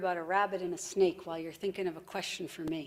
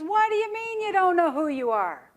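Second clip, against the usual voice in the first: high, loud disbelief; incredulous snake; 8 semitones higher, 7 dB louder than usual. Acted. Snake questions a rabbit who is lost for identity, incredulous. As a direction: demand it in an outraged high voice.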